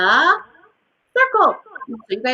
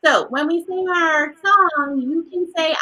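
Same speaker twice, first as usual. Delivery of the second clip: usual speaking voice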